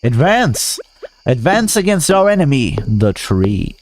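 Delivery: high rallying war cry; rally the troops, high and grand; battle commander. Pretend. Rallying troops against trees.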